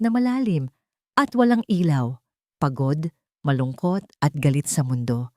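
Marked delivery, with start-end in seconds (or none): none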